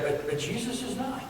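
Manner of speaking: ordinary talk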